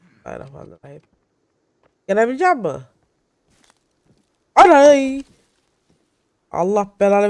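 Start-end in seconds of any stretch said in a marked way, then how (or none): 0.0-1.0 quick, soft whispered prayer. Real, not acted. Scared player whispering a prayer before danger. 4.5-5.2 sudden loud, high yelp; startled cry. Real, not acted. Player startled by a deer in the game, loud high yelp.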